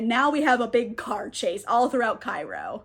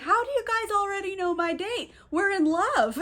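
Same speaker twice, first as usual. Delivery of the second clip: high-pitched baffled protest; confused; 7 semitones higher than usual. Retold or imitated. Alex questioning his friends about his date, confused. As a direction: imitate someone bewildered and a bit wounded. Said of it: "he's really confused".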